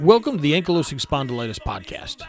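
loud upbeat podcast intro read; announcer voice. Acted. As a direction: announce the show with energy. Podcast intro read over music.

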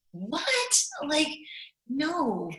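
high, loud disbelief; "are you serious?" outburst; incredulous retort. Retold or imitated. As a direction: react with indignant disbelief. Retells indignant reply to advice.

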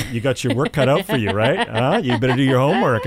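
knowing producers. Retold or imitated. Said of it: "they're going".

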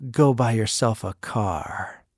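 low, slow and soft, dry presidential deadpan; quiet, unhurried voice of a calm old statesman; Reagan's low slow quip. Retold or imitated. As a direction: drop the voice low and take it slow. Reagan quoted handing over doodle.

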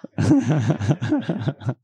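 breaking into open laughter; real laugh. Real, not acted. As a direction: reader cracking up mid-story. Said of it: "Thank you".

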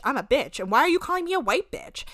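ordinary talk, nothing marked

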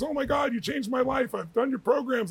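high, gushing imitation of a starstruck doctor; excited fan doctor. Doctor meeting his idol as a patient, imitated high excited gush. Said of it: "was excited when he met me".

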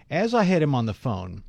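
ordinary delivery

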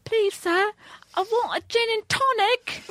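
high, hammed-up mock Cockney; bad British accent. Podcaster mocking her own British bar order. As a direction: ham up a fake London accent. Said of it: "bad attempts at British accents".

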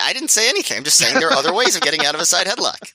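high-pitched, quick feigned protest; mock innocent denial. Joker denying innuendo to co-host, mock innocence.

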